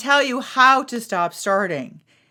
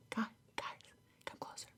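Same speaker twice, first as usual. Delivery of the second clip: hushed conspiratorial whisper; breathy teasing whisper to draw listeners close; 23 dB quieter, faster than usual. Pretend. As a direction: lean in and whisper the secret.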